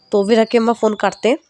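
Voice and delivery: ordinary delivery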